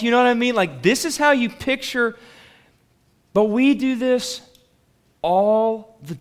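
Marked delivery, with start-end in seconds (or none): none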